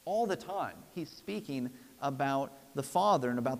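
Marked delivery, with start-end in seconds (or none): none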